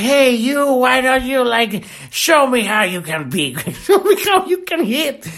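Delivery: high, laughing mock of a drunk taunting; drunk heckler. Drunk passenger taunting Mike Tyson, mocking high voice. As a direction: goad him like a stupid drunk. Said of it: "And he's like".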